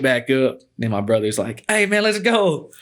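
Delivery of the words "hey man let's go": high, drawn-out urgent call; retold calling out. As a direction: imitate a brother hollering to come along. Brother calling him to leave.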